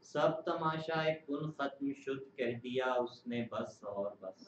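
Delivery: slow measured ghazal recitation; poem recitation. Acted. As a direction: recite the couplet slowly. Recites couplet.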